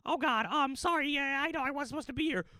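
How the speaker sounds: high, squeaky, apologetic mouse; Mickey. Acted. Roommate caught secretly playing apologizes, high mouse voice. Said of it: "Mickey's, like, secretly playing the Xbox".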